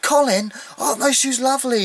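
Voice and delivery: high, put-on gushing voice; gushing imitation. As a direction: squeal it like a ditzy admirer. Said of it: "she said, she said".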